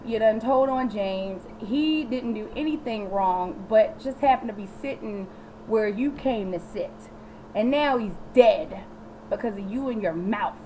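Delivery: normal talking voice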